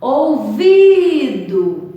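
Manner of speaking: "Ouvido" with a loud, high, long VI; slow "Ouvido", swelling on the VI; stress on VI. Shown as a demonstration. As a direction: hold the VI in "Ouvido".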